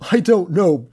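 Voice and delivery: high, loud tearful cry; blubbering. A narrator's reading. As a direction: choke it out through sobs. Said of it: "George blubbered".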